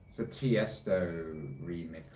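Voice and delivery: ordinary talk, nothing marked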